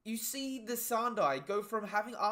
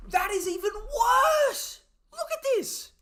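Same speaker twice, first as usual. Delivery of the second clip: high, loud and stretched out in disbelief; excited shout; 10 dB louder, slower than usual. Real, not acted. Reacting to the manga page, a shocked high shout. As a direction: yell it out, stunned by the page.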